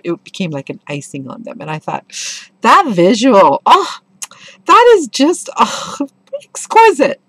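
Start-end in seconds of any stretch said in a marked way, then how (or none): 2.6-6.0 much higher and louder, slow, carried away; gushing delight. Real, not acted. Gushing over a passage. 6.4-7.2 rapturous "exquisite". Real, not acted. Gushing over a passage.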